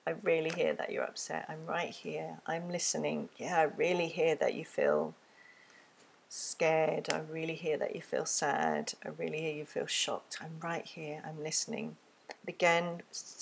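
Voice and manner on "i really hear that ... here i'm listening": put-on soft, gentle reassurance to an upset child; quiet, tender I'm right here; empathic listening. Shown as a demonstration. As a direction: hush down and soothe them. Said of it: "to give empathy".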